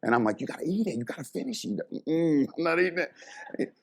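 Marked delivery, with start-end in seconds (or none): 0.4-1.8 high, softened, pressing aside; hushed urging. Retold or imitated. Telling the assistant to finish the crab, hushed high urging. 1.9-3.7 high-pitched mock refusal; imitated stubborn refusal. Assistant refusing the coach's crab, imitated high refusal.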